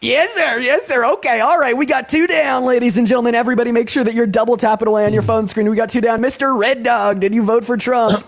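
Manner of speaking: high-pitched live-show hype; hype announcer. Acted. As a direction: announce it like a game-show host. Livestream host hypes up each guest.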